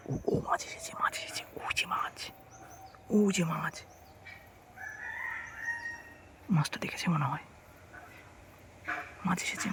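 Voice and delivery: excited hushed whisper at the float. Real, not acted. Vlogger whispering to camera as the fish bites, hushed. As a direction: breathe the words so the fish won't hear. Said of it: "আমি আস্তে আস্তে কথা বলছি".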